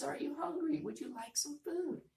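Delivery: high worried voice; worried mother duck. Shown as a demonstration. Teacher rereading Mother Duck's worried question. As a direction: ask it anxiously. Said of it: "I know she's worried".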